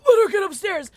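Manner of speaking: loud, high yell at teammates; rising real shout of urgency; frustrated shout. Real, not acted. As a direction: bark it out in frustration. Same words repeated louder.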